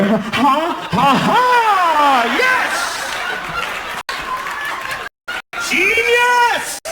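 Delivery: mock triumphant shout of pleasure; mock ecstatic cry. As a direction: cheer it like a breakthrough. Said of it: "she starts to move lower".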